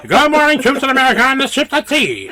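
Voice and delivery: high, loud wartime radio greeting; upbeat military radio host. Retold or imitated. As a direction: hail the troops like a fifties DJ. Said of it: "I'm like".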